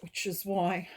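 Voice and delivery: usual speaking voice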